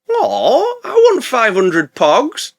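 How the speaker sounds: high, drawn-out whine of wanting; slow, high-pitched begging; mock childish pleading. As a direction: plead like a kid after a treat. Whiny acted quote of Brendan.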